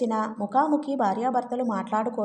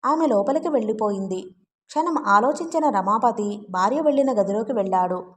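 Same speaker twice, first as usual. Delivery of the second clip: plain reading voice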